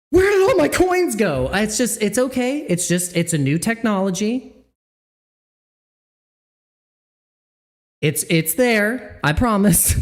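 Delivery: high squeak, where did all my coins go; mock panicked user. Streamer mocking a crypto newbie losing coins, high voice. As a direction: play a fake panicked newbie then soothe.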